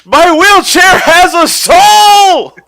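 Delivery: very high, loud, slow yell; hammed-up outraged cry; cartoon shout. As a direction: scream it to the rafters. Character yelling in outrage.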